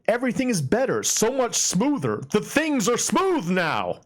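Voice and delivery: high, gushing and slow; excited. Acted. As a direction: swoon over how smooth it is. Gushing over smooth frame rate.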